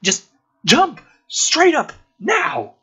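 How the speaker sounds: loud high frantic yell; urgent shout. Acted. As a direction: scream the order to jump, high and urgent. Son urging dad to jump.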